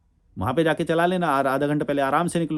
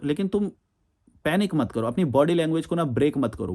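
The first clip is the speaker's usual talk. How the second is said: ordinary delivery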